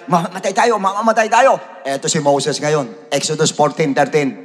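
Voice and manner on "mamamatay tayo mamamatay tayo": panicked crowd. Retold or imitated. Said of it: "natarantay mga Israelite".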